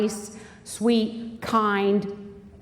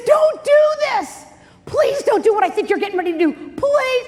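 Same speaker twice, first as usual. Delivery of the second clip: loud, high desperate plea, acted; pleading; 12 semitones higher, 5 dB louder than usual. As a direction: beg him loudly to stop. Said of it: "You know, she's pleading".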